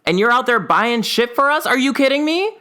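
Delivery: high, indignant disbelief; incredulous kid. Retold or imitated. Twelve-year-old confronting parents about money, incredulous. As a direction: scold them, are you serious.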